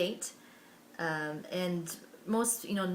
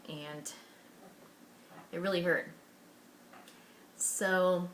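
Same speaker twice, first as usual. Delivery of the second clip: ordinary delivery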